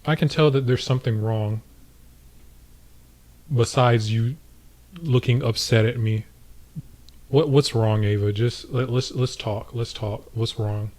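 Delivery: soft, caring coax; tender, soft let's-talk voice; gentle concern. Pretend. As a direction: quietly invite her to open up. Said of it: "I just say to her".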